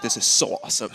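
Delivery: put-on quick whispered German accent line. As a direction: say it like a German, under your breath. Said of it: "I like your German accent for that one".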